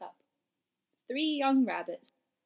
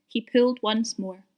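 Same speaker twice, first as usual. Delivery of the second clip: usual speaking voice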